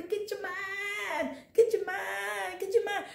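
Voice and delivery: high, strained call to the church; high pleading preach. Acted. Preacher urging the church, high and pleading. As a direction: plead with them to get their mind.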